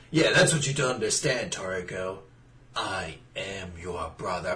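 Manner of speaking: mock melodrama, quiet and low; dramatic soap reveal. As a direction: drop the voice for the big twist. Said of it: "soap opera brother reveal".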